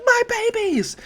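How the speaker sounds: very high, loud wail of horror; distressed shriek. Acted. Mei sees her inventions pulled to pieces, distressed shriek. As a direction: shriek it out in mock anguish.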